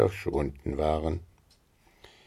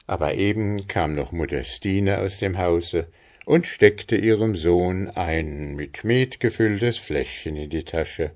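ordinary delivery

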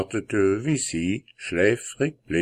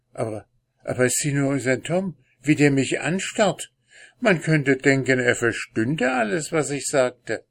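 rushed and startled; 6 semitones higher than usual. Acted. Gravedigger startled by the cat.

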